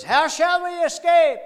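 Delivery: emphatic preacher shout. Retold or imitated. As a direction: thunder it out as the preacher did. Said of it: "he kept emphasizing those three words".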